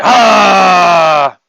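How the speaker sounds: loud exasperated shout of ah; sharp loud groan of annoyance; frustrated loud ah. Acted. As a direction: blurt a frustrated ah. Self-critique burst.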